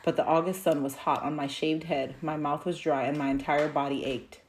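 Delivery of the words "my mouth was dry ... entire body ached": ordinary delivery, nothing marked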